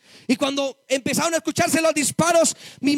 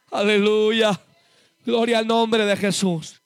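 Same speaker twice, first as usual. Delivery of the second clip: lower, slower praise exclamation; fervent praise; 3 semitones lower, slower than usual. Real, not acted. Preacher praising Jesus before the church, slow and fervent. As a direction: breathe out a slow hallelujah after the climax.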